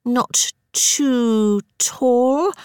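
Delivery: drawn-out, deliberate; very slow. A narrator's reading. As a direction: stretch each word. Sarah dictating her description.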